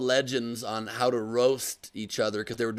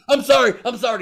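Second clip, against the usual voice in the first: much higher, louder frightened pleading; mock panicked apology; 11 semitones higher, 9 dB louder than usual. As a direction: squeal it like a scared man begging. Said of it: "And Audute's like".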